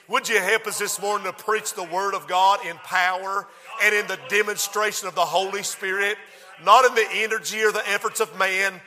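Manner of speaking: ordinary delivery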